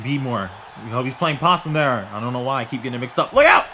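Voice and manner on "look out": sudden high, loud yell; startled commentator holler; warning shout. Real, not acted. Sudden warning during match.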